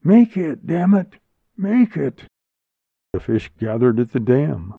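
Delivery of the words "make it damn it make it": high, urgent narrated shout; raised-pitch exclamation, short of a full yell; shouted urging. A narrator's reading. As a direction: cry it out, willing someone on. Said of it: "she shouted".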